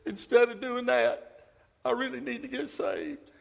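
lightly voiced elderly woman; old lady's voice. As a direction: play the old church member.

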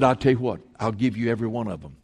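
low, hushed tempter's voice; tempting devil. Acted. Devil offering Jesus the world's kingdoms, low and hushed tempting. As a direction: coax softly and low, like a sly offer.

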